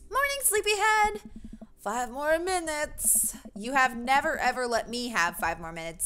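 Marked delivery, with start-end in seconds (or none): 0.1-1.2 very high, lilting morning greeting; cheery wake-up call. Acted. 1.8-3.4 slow, groggy, higher-pitched whine; sleepy plea. Acted. Voicing a sleeper begging.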